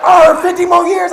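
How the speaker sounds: loud, high burst at the good news; hammed-up elation. Husband reacting to doctor's news.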